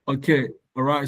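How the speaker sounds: normal talking voice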